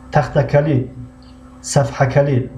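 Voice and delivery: ordinary delivery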